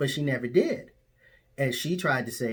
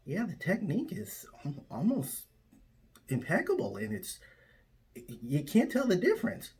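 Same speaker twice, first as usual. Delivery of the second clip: high, hushed mock admiration; awed reply; 8 semitones higher than usual. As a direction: pitch it up and breathe it out in awe. Speaker answers in awed voice.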